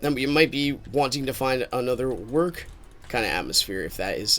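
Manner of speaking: ordinary talk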